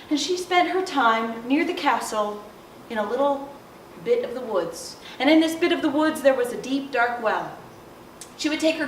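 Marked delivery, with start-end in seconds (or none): none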